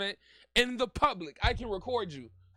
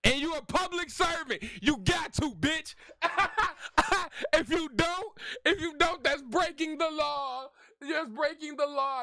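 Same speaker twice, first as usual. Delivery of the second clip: shouting; 3 semitones higher than usual. Real, not acted. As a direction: yell it at him. Yelling at the officer on stream.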